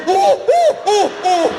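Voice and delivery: startled owl shrieking very high and loud; violated owl hoot. Shown as a demonstration. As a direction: hoot in alarm, pitched far up, then dropping. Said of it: "Am I being fingered?".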